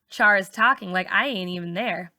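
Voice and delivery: usual speaking voice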